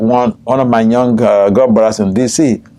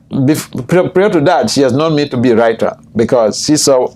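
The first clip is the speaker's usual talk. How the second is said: usual speaking voice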